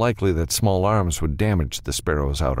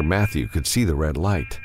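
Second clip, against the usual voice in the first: ordinary delivery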